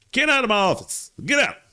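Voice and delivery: loud, high-pitched angry yell; angry shouting. Acted. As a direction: play a boss throwing someone out. Acts boss throwing intern out.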